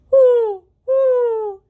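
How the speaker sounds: high, loud hooting woo woo; owl hoot. Acted. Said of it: "says the owl".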